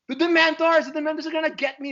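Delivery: high, loud panicked wail, rushing the end; hammed-up fear of the Dementors; a mom waking from a nightmare, mock terror; imitating terrified mom.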